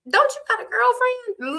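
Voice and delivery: high, surprised blurt; high-pitched mock-shocked question; retold blurt. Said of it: "that's the first thing I said".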